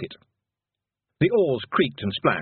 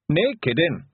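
put-on broad Scottish accent; 5 semitones higher, 3 dB louder than usual. Rob replies.

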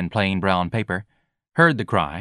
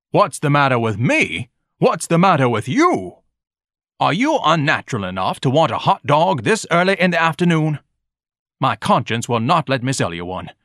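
raised, haughty scolding; pompous Ignatius, indignant; 5 semitones higher, 3 dB louder than usual. A narrator's reading. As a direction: lecture the boy with lofty outrage. Voicing Ignatius lecturing the boy.

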